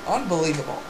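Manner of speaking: soft, low disbelief; stunned murmur. Pretend. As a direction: say it under your breath, stunned. Stunned commentary, first take.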